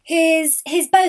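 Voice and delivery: high, loud, caught-off-guard stammer; surprised stammer. A narrator's reading. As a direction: trip over the words, startled. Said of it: "they stammered in surprise".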